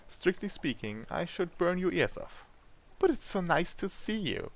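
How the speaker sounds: playful Deviless. Acted. Deviless greeting her rescued guest, playfully. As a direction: tease sweetly with swooping high pitch.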